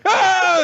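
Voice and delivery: high, loud imitation scream; mock meltdown scream. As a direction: shriek as a villain whose plans collapse. Imitates Light's breakdown scream.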